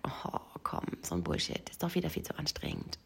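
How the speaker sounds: quietly brushing it off, imitated; dismissive head voice. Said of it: "mein Kopf hat aber gesagt".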